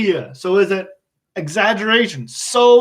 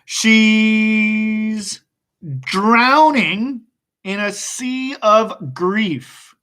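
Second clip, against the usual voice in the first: slow dictation; much slower than usual. Shown as a demonstration. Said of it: "So drowning".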